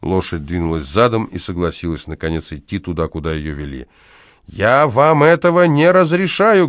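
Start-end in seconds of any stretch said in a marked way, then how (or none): 4.5-6.7 high, loud, slow protest; indignant forbidding. A narrator's reading. House manager forbidding a horse in the flat, indignant. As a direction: forbid it loudly, drawing out each word.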